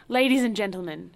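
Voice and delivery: loud, pompous call to the table; grand announcement. A narrator's reading. Host announcing to his dinner guests, loud and pompous. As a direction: announce it like a showman to the room. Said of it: "he would announce at dinner".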